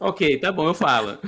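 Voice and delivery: loud laughing concession; laughing give-in. Real, not acted. Student giving in to the teacher, loud and laughing.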